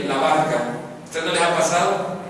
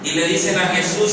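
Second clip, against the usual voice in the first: usual speaking voice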